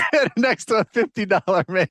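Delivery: cracking up while teasing, voice high; laughing. Real, not acted. Cohost laughing at the fifty dollar man slip.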